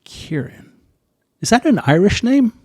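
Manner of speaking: Kieran whispered, then the question shot up high and loud; playful rising disbelief at the Irish name; mock surprise. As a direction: act out the chief pilot catching the Irish name. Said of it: "And he's like".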